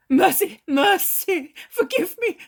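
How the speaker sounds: high, loud desperate plea; old man flings himself down crying for mercy; begging shout. A narrator's reading.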